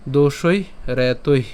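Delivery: slow, careful "দোষৈ রে তৈ"; pronunciation demo. Shown as a demonstration. Teacher shows learners the whole phrase, slow careful pronunciation. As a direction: say "দোষৈ রে তৈ" slowly, releasing breath on the final visarga. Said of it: "যদি উচ্চারণটি করি, পুরোটা কেমন হবে".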